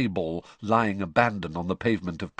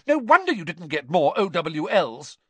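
angry mother; 10 semitones higher, 4 dB louder than usual. A narrator's reading. Mother continues tirade.

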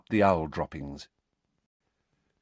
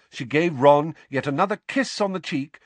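ordinary delivery, nothing marked

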